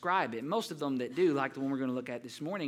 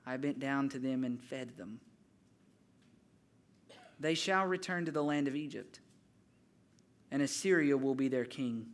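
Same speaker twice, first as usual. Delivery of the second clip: solemn scripture reading. Acted. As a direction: lay each phrase down gently like holy words. Reads Hosea scripture aloud.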